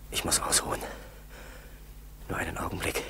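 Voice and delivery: faint, spent whisper; exhausted whisper. Acted. Starving pilot begging himself for rest, exhausted whisper. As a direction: whisper it, completely worn out.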